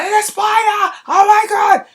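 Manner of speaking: very high, loud shrieking alarm; panicked scream. Retold or imitated. Wife spotting a big spider, screaming in panic. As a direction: shriek it like the spider just dropped at your feet. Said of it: "So I scream".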